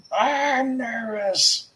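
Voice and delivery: high, slow nervous whine. Real, not acted.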